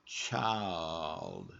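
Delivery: put-on child with the L clearly heard, chy-uld. Shown as a demonstration. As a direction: lean into the L in child. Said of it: "you can hear that L working".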